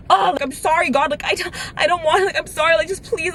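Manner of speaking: high, pleading retold prayer; tearful praying. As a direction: beg God desperately, as if close to crying. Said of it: "crying and praying to God".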